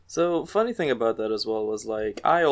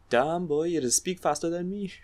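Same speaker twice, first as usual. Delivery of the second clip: higher-pitched, mock Trinidadian jeer; Trinidadian accent; 7 semitones higher than usual. Retelling taunts from jackasses in Trinidad, mock Trinidadian accent. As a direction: put on a teasing Trinidad accent. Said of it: "jackasses, uh, in the, in Trinidad".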